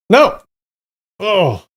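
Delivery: high, loud cry of dismay. Real, not acted. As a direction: shout no at the wrong pick. Wrong answer in quiz.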